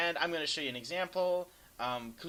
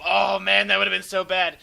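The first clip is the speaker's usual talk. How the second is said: relieved exclamation; 6 semitones higher, 15 dB louder than usual. Real, not acted. Relief after near miss.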